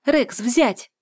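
high, loud sharp command; dog command. A narrator's reading. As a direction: order the dog to attack. Ordering dog to attack.

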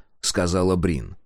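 usual speaking voice

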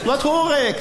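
high, prim mock-innocent voice; hammed-up high innocent questioning. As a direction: play dumb in a high polite voice. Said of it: "Ik zeg".

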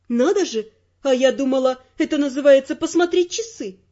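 usual speaking voice

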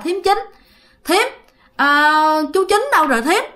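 high called-out question to the sister-in-law; calling out. Acted. Older brother calling to the sister-in-law, high and anxious. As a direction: call out to her from the doorway. Neighbor calls for his brother.

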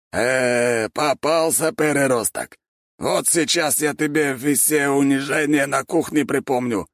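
high-pitched gloating threat; taunting rival. A narrator's reading.

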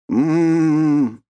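high, drawn-out "mmm" moan; exasperated groan. A narrator's reading. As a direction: groan it, pitched well up. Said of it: "простонал Горван". (страдальческий стон)

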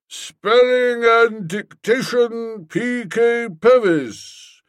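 high, slow street-hawker call; calling coals. Acted. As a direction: call it out like a coal seller. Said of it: "as if he were calling coals".

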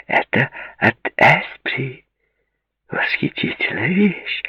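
high, slow, savouring show-off; triumphant. A narrator's reading. Bride-to-be showing her friend the ring, triumphant. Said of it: "торжествующе произнесла Ширли".

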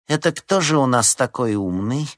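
menacing. A narrator's reading. Head teacher confronting the pupils, menacing. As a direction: demand an answer with a touch of threat. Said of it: "грозно осведомилась она".

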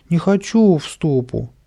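put-on high, whiny child's refusal; whining. A narrator's reading. Boy refusing the grandmother's threat, whining. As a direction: whimper it in protest. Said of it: "опять захныкал Каприска".

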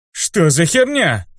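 stunned, blurting it out; surprised. Acted. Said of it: "изумился".

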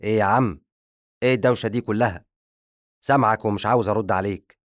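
loud, fast irritated complaint; angry retort. Acted. The servant barging in on the zombie, loud fast irritated retort. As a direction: snap back at the boss, fed up. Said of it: "يصيح في الزومبي بغضب".

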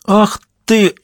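high, sharp indignant cry; angry outburst. Acted. Drunk husband lunging at the detective, angry outcry. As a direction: flare up as you lunge at him. Drunk man starts a fight.

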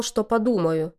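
plain reading voice

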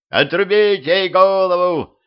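high, slow frenzied shriek. Acted. Бесноватый Гриша визжит палачу приказ, высокий визг. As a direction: screech the order like a madman. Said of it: "завизжал бесноватый Гриша".

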